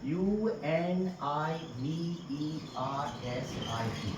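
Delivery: slow letter-by-letter spelling. Shown as a demonstration. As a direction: spell U N I V E R S I T Y one letter at a time. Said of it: "इसका उच्चारण होता है यूनिवर्सिटी".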